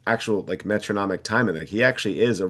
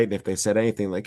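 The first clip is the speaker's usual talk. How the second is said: normal talking voice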